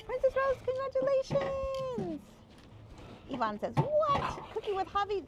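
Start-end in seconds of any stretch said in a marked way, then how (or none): 0.1-2.2 excited congratulations. Real, not acted. Congratulating a viewer on graduating, excited high cheer. 3.8-5.3 high-pitched mock surprise; acted amazement. Reading a viewer's comment aloud, mock surprise.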